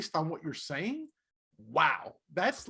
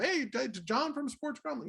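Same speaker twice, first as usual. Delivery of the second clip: slow, higher announcer-style proclamation; mock announcer; 7 semitones higher, slower than usual. Ron introducing him to the world, mock announcer. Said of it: "he'll pretend like he's announcing it to the world".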